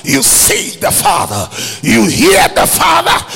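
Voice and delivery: loud, driving preacher's shout; full-force preaching. Real, not acted. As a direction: hammer each phrase out to the congregation.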